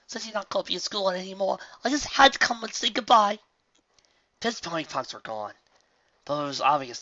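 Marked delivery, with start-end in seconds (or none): 0.1-3.4 high, eager little colt; young colt voice. A narrator's reading. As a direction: say goodbye earnestly. Colt farewell.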